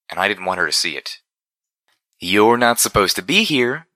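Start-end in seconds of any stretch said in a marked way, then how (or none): 2.2-3.9 cheerful robot pony voice, higher and slow; chirpy machine scolding an intruder; mechanical Pinkie Pie. A narrator's reading. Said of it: "sounded disturbingly like a mechanical Pinkie Pie".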